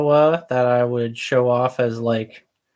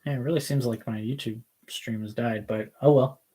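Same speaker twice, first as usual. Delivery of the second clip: ordinary delivery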